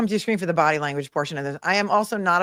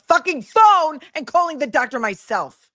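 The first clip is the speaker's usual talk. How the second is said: angry rant; 8 semitones higher, 7 dB louder than usual. Real, not acted. Ranting about doctor not calling.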